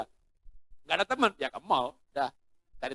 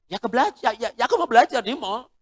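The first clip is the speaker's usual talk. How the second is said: put-on high, louder whiny teen voice; son pleading; 8 semitones higher, 6 dB louder than usual. Son begging father to study at the mall, high and whiny. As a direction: wheedle dad into saying yes.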